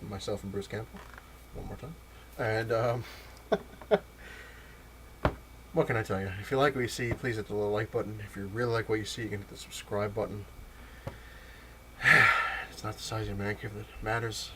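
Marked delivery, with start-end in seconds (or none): 3.5-4.4 high, airy little laugh; short laugh. Real, not acted. Laughs while signing off. 12.0-12.7 loud, short sigh. Real, not acted. Sighs before the closing line.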